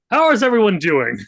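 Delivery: high, loud shouted greeting. Retold or imitated. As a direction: holler it at a hungover class, pitch falling away. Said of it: "very loudly a few times".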